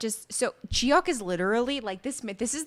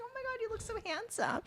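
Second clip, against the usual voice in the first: high, soft, fast gushing; swooning heroine; 11 semitones higher, 12 dB quieter, faster than usual. Retold or imitated. Friend voicing the heroine's crush, high soft coo. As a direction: coo it like a smitten coworker.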